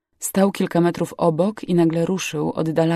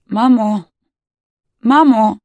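loud, higher cry for mother; frightened calling; 4 semitones higher, 8 dB louder, slower than usual. A narrator's reading. Girl calls mother amid rescue noise, frightened cry. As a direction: call out urgently, voice lifted.

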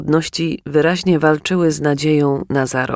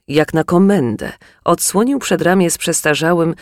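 plain reading voice

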